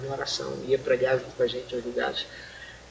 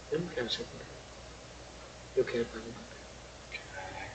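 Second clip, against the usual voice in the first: hushed; 10 dB quieter than usual. Retold or imitated. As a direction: say it quietly, barely voiced, like a sick man. Dying pastor's words retold. (sussurro lento e fraco do pastor doente)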